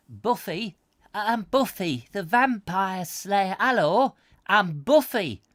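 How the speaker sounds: high put-on Buffy voice; mock Buffy. Spoofing Buffy for viewers, in a high silly voice. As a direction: camp up the slayer intro. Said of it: "they were all after him".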